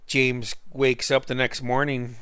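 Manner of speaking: ordinary talk, nothing marked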